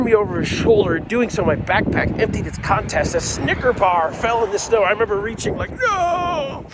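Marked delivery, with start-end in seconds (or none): 5.7-6.7 much higher, far-off cartoonish cry of loss; anguished no. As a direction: wail it as the candy drops away. Reaching for falling candy bar.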